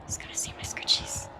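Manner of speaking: hushed unvoiced whisper. Real, not acted. Hiding from the killer near the scratch marks, whispering. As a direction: whisper it so the killer won't hear.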